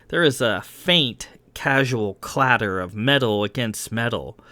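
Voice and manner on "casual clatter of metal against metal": plain reading voice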